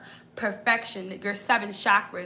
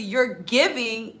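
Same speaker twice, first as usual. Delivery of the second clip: ordinary talk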